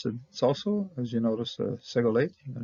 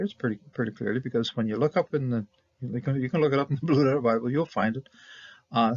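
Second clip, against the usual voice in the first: ordinary talk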